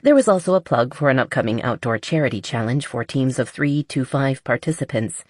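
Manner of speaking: ordinary delivery, nothing marked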